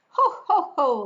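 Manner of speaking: high, loud ho ho ho; hearty mocking laughter; teasing laugh. A narrator's reading. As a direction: jeer with a laugh. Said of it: "laughed the second little pig".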